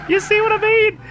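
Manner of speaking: straining to up pitch. Shown as a demonstration. Showing listeners the ruined vocals, straining for high pitch. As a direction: push the voice right up high. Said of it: "I can't even up pitch".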